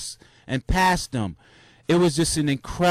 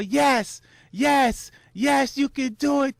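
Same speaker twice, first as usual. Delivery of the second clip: rising, higher self encouragement; self pep talk; 9 semitones higher than usual. Real, not acted. Runner cheering himself on in the last miles, high and excited. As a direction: pump yourself up to finish. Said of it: "I'm saying this to myself".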